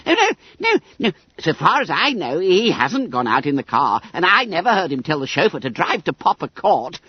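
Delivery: high, squeaky fat schoolboy; Bunter voice. A narrator's reading. Bunter voice again.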